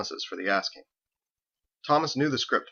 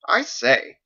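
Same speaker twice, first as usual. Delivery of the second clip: pompous cleric; 7 semitones higher, 6 dB louder than usual. A narrator's reading. Thomas's catchphrase.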